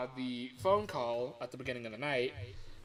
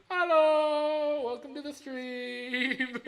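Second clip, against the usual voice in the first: loud greeting; 11 semitones higher, 8 dB louder, much slower than usual. Real, not acted. Greeting stream viewers again.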